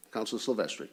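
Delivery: normal talking voice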